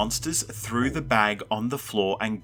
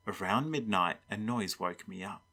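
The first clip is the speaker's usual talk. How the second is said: ordinary delivery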